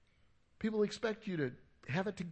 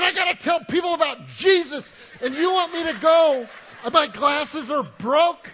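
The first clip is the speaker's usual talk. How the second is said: high, shouted indignant rant; exasperated rant; 10 semitones higher, 16 dB louder than usual. Retold or imitated. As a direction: hammer out the outrage at full volume. Re-enacts exasperated thoughts after wife's request.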